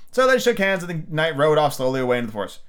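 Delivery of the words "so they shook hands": plain reading voice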